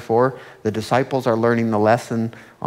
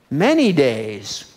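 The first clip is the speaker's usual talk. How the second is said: slow, drawn-out emphasis; 4 dB louder, slower than usual. Acted. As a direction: stretch out "Many days" slowly, weighing each word. Dwelling on the long ordeal.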